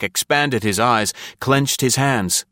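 ordinary delivery

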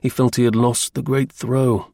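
plain reading voice

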